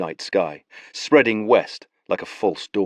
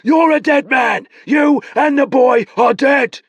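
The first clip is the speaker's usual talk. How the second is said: high, loud threatening shout, slowing at the end; furious shouted threat; 7 dB louder than usual. Acted. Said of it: "Nabor shouted".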